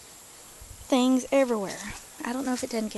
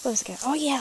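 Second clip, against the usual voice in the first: normal talking voice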